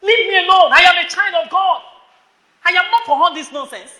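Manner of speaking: high, loud refusal; loud rebuke. Shown as a demonstration. Preacher modelling a loud rebuke to a boy. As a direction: shout it as a rebuke. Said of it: "you said it, rebuke".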